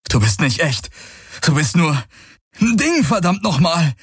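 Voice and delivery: rising, agitated outburst; angry rejection. Acted. Man denies the illusion is real, increasingly furious. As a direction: build from protest to high-pitched cursing.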